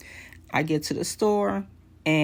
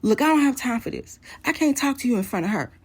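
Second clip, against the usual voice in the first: higher-pitched, impatient husband; retold angry snap, pitched up; imitating irritated brush-off; 8 semitones higher, faster than usual. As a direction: act out an exasperated dismissal on the phone. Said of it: "He does the same thing again".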